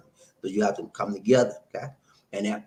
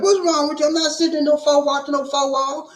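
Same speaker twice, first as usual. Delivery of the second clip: high, louder mock outburst; mock exasperated outburst; 5 dB louder than usual. Husband retelling his shout at his wife, high and loud. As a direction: throw up your hands at staying in. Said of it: "I was like".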